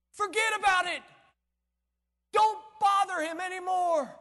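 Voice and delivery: high, loud call-off; urgent dismissal. Acted. Messenger telling Jairus to stop bothering the master, urgent dismissal. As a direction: shout it to wave him away.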